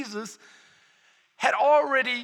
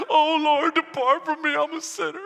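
mock awed Peter; 6 semitones higher, 4 dB louder than usual. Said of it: "he's like".

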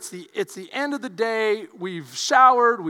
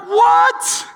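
shocked what; 13 semitones higher, 9 dB louder than usual. Retold or imitated. Shocked reaction to brother's words.

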